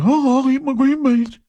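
put-on high, whimpering child voice; hammed-up tearful kid; six-year-old boy. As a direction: give in as a whiny little boy. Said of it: "six-year-old boy".

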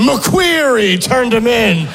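1 disgusted mock voice. Comic mock game answers.

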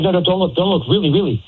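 high-pitched imitation of frantic pleading; urgent pleading imitation. Man retells his date begging him not to look, high urgent mimicry.

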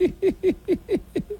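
a burst of high laughter. Real, not acted. Host laughing at the caller's boast.

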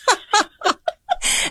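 sudden high laugh, real amusement; high burst of laughter. Real, not acted. Laughing at priest's question.

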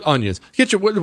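usual speaking voice

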